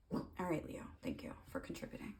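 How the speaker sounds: fast soft unvoiced whisper to Leo; dry whispered thanks; whispered aside. Real, not acted. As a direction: murmur it off-mic, dryly.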